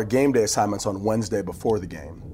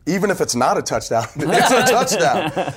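laughing through it; 7 semitones higher, 4 dB louder than usual. Retold or imitated. Laughing while quoting himself.

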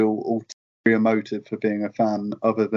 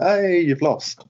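retold gloat, higher and slow; mock gloating; 8 semitones higher, slower than usual. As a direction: crow over the win, drawn out. Flair gloats after picking up ball.

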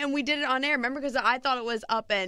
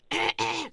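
mock congested throat-clearing; imitated throat clearing. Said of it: "he's like".

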